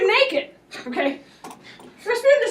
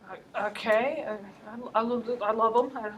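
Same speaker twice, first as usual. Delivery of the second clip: nervous stammer; 5 semitones lower, 11 dB quieter than usual. Retold or imitated. Terrified policeman stammering.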